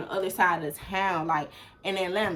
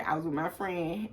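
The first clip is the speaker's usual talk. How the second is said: ordinary talk, nothing marked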